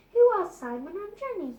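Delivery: put-on a quick, bright kid's question; small boy blurting it out fast; Bertie's kid voice. A narrator's reading.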